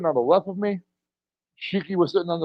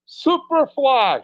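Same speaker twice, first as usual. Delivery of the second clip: drawn-out wrestling call; 8 semitones higher, much slower than usual. Retold or imitated. Kid calls move before jumping.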